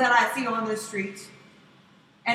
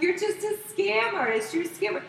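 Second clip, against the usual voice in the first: retold accusation; 8 semitones higher than usual. Acted quarrel with the panhandler.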